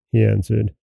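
usual speaking voice

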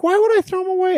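put-on high, indignant teen voice; high incredulous kid voice; mock teen whine. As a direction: squeak out the protest like a teen. Daughter quoted, incredulous.

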